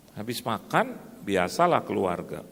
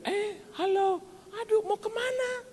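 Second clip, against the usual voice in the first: very high, gushing hello; acted excited greeting. Acquaintances meeting on the road, high acted excited greeting. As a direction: greet them in a high, surprised lilt.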